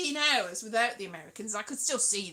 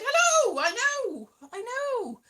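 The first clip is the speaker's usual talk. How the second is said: retold greeting; 10 semitones higher, 5 dB louder, slower than usual. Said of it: "And I said".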